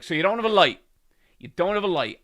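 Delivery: badgering him as he chases you; pushy repeated question. Stranger pursuing, repeating question.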